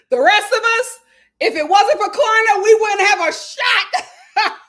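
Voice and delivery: loud, high, breaking into laughter; laughing shout. Real, not acted. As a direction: shout the joke through real laughter. Joking at own expense, laughing.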